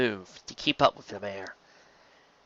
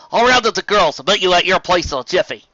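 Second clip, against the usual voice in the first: determined Applejack; 6 semitones higher, 8 dB louder than usual. A narrator's reading. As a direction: promise it firmly.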